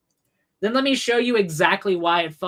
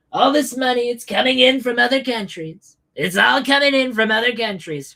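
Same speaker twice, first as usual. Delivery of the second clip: high, loud parody of a political talking point; mockingly insistent, pitched up; mock narrative; 6 semitones higher, 4 dB louder than usual. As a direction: parrot the claim in a raised, put-on voice. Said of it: "I know that the narrative, right, is that other countries pay".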